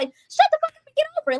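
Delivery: squeaky cartoonish brush-off, mocking him; hammed-up dismissive guy; mocking his dismissal. Imitating him brushing her off.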